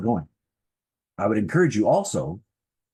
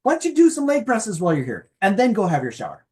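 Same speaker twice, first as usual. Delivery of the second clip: higher, louder cheerful nudge to yourself; upbeat self-coaching; 11 semitones higher, 5 dB louder than usual. Retold or imitated. Coaching yourself at the gym, bright and louder. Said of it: "then as".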